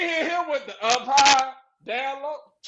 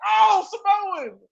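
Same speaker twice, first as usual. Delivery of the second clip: mock bare call; 6 semitones higher, much slower than usual. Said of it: "You can't just be like".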